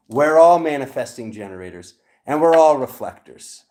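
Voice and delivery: loud, emphatic insistence; riled. Real, not acted. Reader correcting believers in spiritual labels, loud and riled. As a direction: punch it out loudly, fired up. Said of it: "I told you I was gonna get riled".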